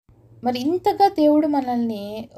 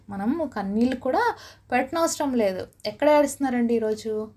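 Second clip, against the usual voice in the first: normal talking voice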